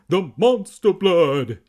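loud, slow, drawn-out cry; alarmed shout. A narrator's reading. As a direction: bellow the warning. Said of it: "Evan cried".

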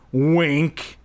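sly spoken wink. Pretend. Reader adds a joking wink to Keith's line, sly spoken aside. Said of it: "spoken stage direction joke".

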